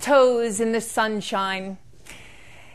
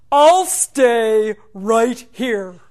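put-on very slow, louder old tree; deep rich tree voice; 2 semitones lower, 7 dB louder, much slower than usual. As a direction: let each word settle like roots. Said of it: "in a deep, rich voice".

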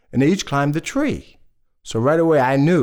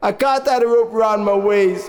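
higher-pitched, plaintive reply; man down a well; 5 semitones higher than usual. Acted. Injured father refuses the rope round his waist, plaintive. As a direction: call up helplessly from the well. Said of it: "The fella down the well says".

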